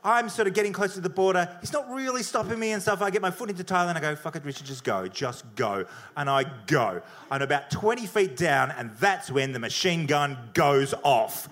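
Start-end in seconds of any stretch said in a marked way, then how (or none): 4.2-5.8 low, soft inner voice; quiet self-urging. Retold or imitated. Traveler urging himself over the border, quiet and low. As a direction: talk yourself into it under your voice.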